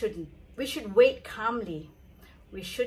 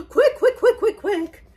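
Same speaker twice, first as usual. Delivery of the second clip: high, loud laughing quicks; frantic hurry; 11 semitones higher, 10 dB louder than usual. Acted. A servant hurrying the others, high laughing urgency. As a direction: rush everyone along.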